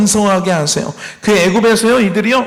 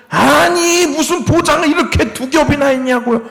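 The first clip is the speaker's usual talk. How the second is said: whiny complaint; 7 semitones higher than usual. Acted. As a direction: play the ungrateful receiver griping. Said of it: "불평하고 있는 거예요".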